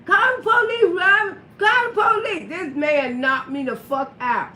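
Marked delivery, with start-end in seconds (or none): 0.1-2.5 urgent shout. Retold or imitated. Retold call for police.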